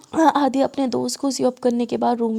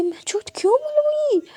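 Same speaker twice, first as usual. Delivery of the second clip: much higher, squeaky protest; indignant denial; 13 semitones higher than usual. A narrator's reading. Friend accused of lying by the girl, high-pitched indignant denial.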